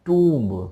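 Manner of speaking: Tomb said loudly with a long oo; loud, isolated "Tomb"; pronunciation demo. Shown as a demonstration. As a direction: draw out "Tomb" for the class. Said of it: "it's tomb".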